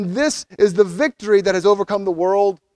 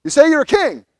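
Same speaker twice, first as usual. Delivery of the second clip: lightly voiced triumphant Pilate, higher and louder; a touch of gotcha in it; 6 semitones higher, 4 dB louder, faster than usual. Pilate pleased he finally understands, mock triumph. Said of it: "Pilate says".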